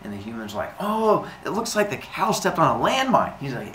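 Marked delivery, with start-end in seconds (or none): 0.8-3.4 higher-pitched naive wonder; mock awe, pitched up; amazed humans. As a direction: react like an impressed simpleton. Humans marvel at pile.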